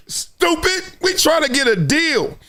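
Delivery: high-pitched exasperated telling-off; imitated incredulous rebuke; mock scolding agent. Agent scolds the player, acted.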